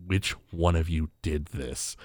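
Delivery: low, soft, slow menace; a dad's quiet threat, slow and low; menacing. Retold or imitated. Homer demands who broke the couch, low soft menace. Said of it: "immediately like".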